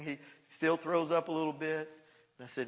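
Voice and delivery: ordinary talk, nothing marked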